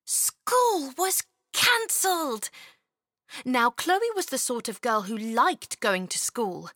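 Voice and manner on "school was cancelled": thrilled. A narrator's reading. Narrator's burst of good news.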